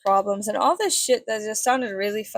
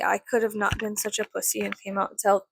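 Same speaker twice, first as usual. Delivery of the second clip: ordinary talk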